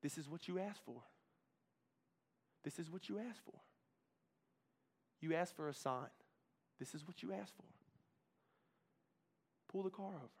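soft voice urging him to pull over. Retold or imitated. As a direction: murmur it gently. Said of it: "that soft voice was saying".

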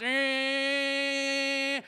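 imitated electric buzz of a toy football game. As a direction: make the toy's flat mechanical hum. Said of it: "that goes".

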